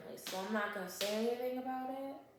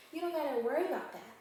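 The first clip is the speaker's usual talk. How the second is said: fast, higher, breezy brag; cocky kid; 5 semitones higher, 4 dB louder, faster than usual. Retold or imitated. Eight-year-old James assuring Camille he's armed, fast and cocky. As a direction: toss it off like a tough little kid.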